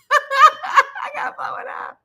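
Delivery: high laughing voice breaking up; high, laughing while sharing a photo. Real, not acted. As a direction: laugh through the words, pitch up.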